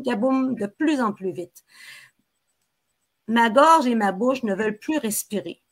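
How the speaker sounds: plain reading voice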